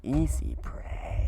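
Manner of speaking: loud hissing whisper, slow; buzzing insect swarm chorus. Acted. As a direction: drag it out like a starving swarm. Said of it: "Do your best swarm voice".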